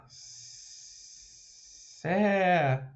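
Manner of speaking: século stretched very slow and soft as sé-cu-lo; split into syllables. Shown as a demonstration. Teacher breaking the word down for students, slow and soft. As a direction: lay out século one syllable at a time, quietly. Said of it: "Então, vai ficar, ó".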